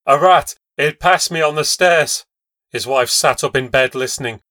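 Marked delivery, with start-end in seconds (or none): 0.1-2.2 shaking tones. Acted. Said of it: "in shaking tones".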